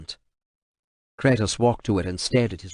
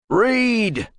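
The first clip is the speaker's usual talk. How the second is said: higher, clipped single-word order; sharp command; 13 semitones higher, 3 dB louder than usual. Acted. Kratos ordering the translator, sharp command. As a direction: bark the order.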